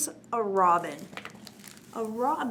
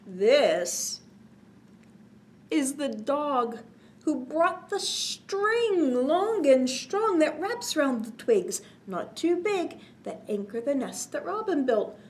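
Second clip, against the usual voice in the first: put-on reading to children; 3 semitones higher, 6 dB louder, slower than usual. A narrator's reading. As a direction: bounce the rhyme for little listeners. Reads cumulative rhyme to children.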